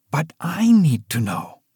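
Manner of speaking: usual speaking voice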